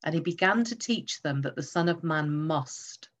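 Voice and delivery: teaching read that singles out "must"; stress on must. Shown as a demonstration. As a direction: land hard on "must" and stop there. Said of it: "as I put the emphasis on one key word".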